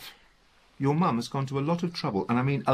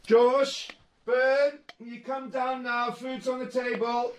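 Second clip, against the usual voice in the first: calling upstairs; 13 semitones higher than usual. Acted. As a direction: call up the stairs. Calling the boys down.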